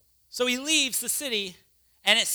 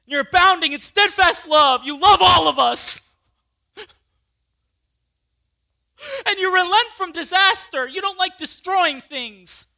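loud, higher, on the edge of tears; bitter cry; 7 semitones higher, 10 dB louder than usual. Acted. Jonah crying out at merciful God, loud and near tears. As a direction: wail the complaint at God.

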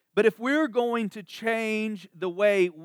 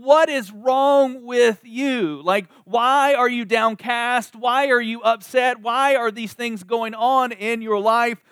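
higher, louder, slow exasperated self-rebuke; psalmist scolding himself; 4 semitones higher, 5 dB louder than usual. Acted. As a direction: plead with your own soul, slowly. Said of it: "this is the psalmist going".

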